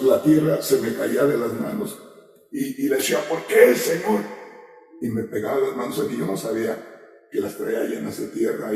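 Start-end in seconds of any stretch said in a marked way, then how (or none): 3.3-4.2 más agudo y fuerte, clamando al Señor; clamor a gritos. Retold or imitated. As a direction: grita la pregunta al cielo. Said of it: "Y yo gritaba". (grito desesperado al Señor)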